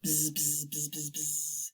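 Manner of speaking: low, soft bee buzz; short buzzes, low and quiet. Acted. Showing children how bees buzz, low buzzing. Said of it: "The bees buzzed".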